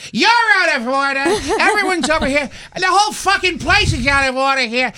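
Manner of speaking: high, hammed-up courtroom tirade; full-force outraged movie-star impression, spitting it out; young Al Pacino. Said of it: "when he was young, he was like".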